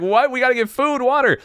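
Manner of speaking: high, loud mock-frantic woman; panicked woman. Survival partner protests his fasting plan, high mock panic.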